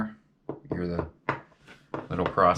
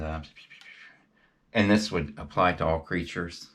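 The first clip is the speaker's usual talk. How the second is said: usual speaking voice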